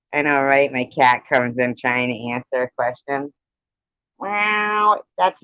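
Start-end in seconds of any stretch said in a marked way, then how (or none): 4.2-5.0 high playful meowing; mock cat meows. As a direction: squeak out a cat's reply. Cat tries to answer.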